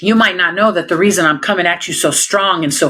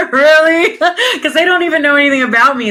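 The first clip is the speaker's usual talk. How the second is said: higher and louder, fast, through a laugh; laughing disbelief; 9 semitones higher, 4 dB louder, faster than usual. Real, not acted. Officer scoffing at racism accusation, laughing. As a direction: laugh at the absurdity of it.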